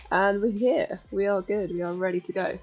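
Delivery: ordinary talk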